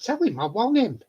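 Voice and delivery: normal talking voice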